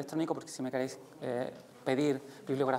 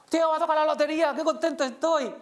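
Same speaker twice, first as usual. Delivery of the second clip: much higher, louder, fast and bursting with joy; overjoyed demo; 11 semitones higher, 8 dB louder than usual. Shown as a demonstration. Teacher demonstrating lottery-win joy: much higher, louder, fast. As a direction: shout the good news like you just won. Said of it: "Y subo el volumen, subo el tono emocional, lo convierto en un agudo".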